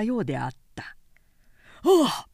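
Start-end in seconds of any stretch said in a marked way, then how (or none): 1.8-2.3 high, loud frightened gasp; sudden scared "ああ"; startled cry. Acted. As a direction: cry out at the thunder. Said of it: "箸を投げ、両耳を塞いで".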